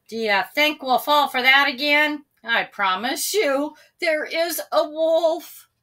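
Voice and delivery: pleading. A narrator's reading. Sam pleads with villagers.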